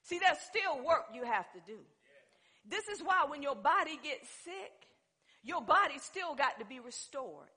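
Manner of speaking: loud, high-pitched pulpit delivery; full-force preaching. Real, not acted. Preacher telling people their lifestyle must match belief, forceful preaching.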